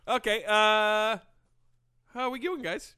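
drawn-out awkward greeting; awkward slow opener. Real, not acted. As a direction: stall it out slowly. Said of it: "What the hell was that?".

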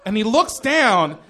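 ordinary talk

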